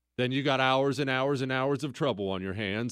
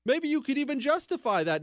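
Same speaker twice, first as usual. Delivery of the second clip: ordinary delivery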